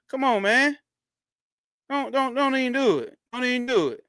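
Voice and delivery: calling out. Real, not acted. Host hollering at Instagram viewers to come to YouTube. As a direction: holler at them to come over, half joking.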